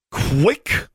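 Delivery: high, spiteful jab of a word; venomous. A narrator's reading. Boy recognising a rival in the dark, venomous. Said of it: "spoken with such venom".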